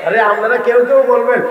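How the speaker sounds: ordinary talk, nothing marked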